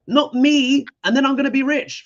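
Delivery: high, loud, gloating mock voice; mocking Trump voter. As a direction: sneer it out as a smug greedy voter. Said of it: "They were like".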